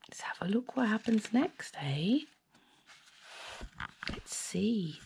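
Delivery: quick whispered aside. Real, not acted. Reader turning the page, whispering aside.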